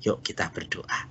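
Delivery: whispered invitation to pray. Real, not acted. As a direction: whisper it softly. (berbisik mengajak berdoa)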